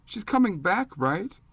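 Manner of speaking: high, scared little voice, slow at first; a small boy begging to be told it isn't true; frightened boy pleads that his mother will return; frightened child. Acted. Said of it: "even more frightened and confused".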